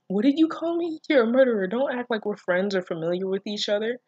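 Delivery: high, loud offended voice; indignant girl. Acted. Reader voices Shizuka rejecting the nickname.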